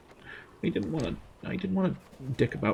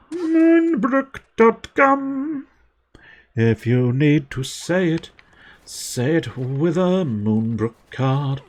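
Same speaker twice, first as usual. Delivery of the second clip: slow, high cheesy ad slogan; mock advert; 5 semitones higher, much slower than usual. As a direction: sell it like a corny TV commercial. Mock advert for game town.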